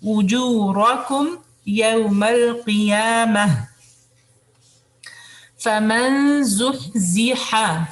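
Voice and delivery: slow melodic tajweed recitation; Quran recitation. Shown as a demonstration. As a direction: chant the verse slowly and evenly.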